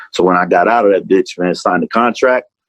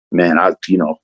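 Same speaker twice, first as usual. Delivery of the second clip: ordinary talk, nothing marked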